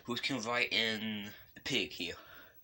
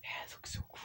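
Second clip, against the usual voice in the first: whisper; 11 dB quieter, faster than usual. Real, not acted. Quiet aside while filming.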